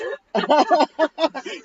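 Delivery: loud high giggling; loud laugh. Real, not acted. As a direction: laugh hard at yourself. Laughing at denture slip.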